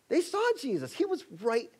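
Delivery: preacher to congregation, exclaiming; emphatic. Real, not acted. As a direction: hammer home the irony. Preacher stressing irony.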